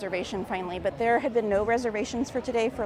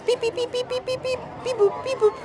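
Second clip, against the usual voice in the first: high, quick beep-boop chirps; robot beeps; 13 semitones higher than usual. Pretend. Reading beeps from chat.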